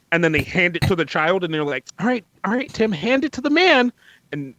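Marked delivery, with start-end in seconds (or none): 2.0-3.9 higher, coaxing parent voice; imitating a coaxing mom. Parent urging toddler to give the item, coaxing mom voice. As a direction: sweet-talk the toddler into handing it over.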